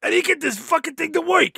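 high, strained frustrated mutter; struggling to get the golf cart going; frustrated grumble. Retold or imitated. Voicing Howard's frustration.

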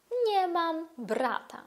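slow repeat of Nie mam brata; Polish model. Shown as a demonstration. Teacher repeating the sentence for students. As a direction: say Nie mam brata again slowly for repetition. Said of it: "Можемо сказати".

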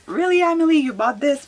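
high, loud mock incredulous viewer; playful fake outrage at a purchase; mock disbelief. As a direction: tease yourself like a shocked fan. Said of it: "You guys are gonna be like".

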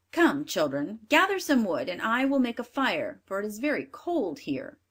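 loud, bossy commanding stepmother; wicked stepmother. Acted. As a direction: order the children about. Stepmother orders children.

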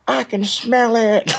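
slow, high, squeaky; hammed-up sniffing accusation; mock accusing crowd. Imitating the meeting members accusing them of smelling of alcohol, slow and squeaky. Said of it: "they're like".

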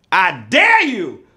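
usual speaking voice

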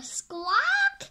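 high, loud squawk; parrot squawk. Acted.

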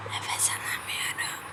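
voiceless gasped whisper; breathless whisper. Pretend. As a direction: whisper as if choking for air. (nefessiz fısıltıyla)

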